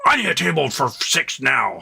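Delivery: slow, strained angry-customer impression; mock an irate man demanding service; hammed-up angry demand; pissed-off customer. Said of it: "already pissed off and angry".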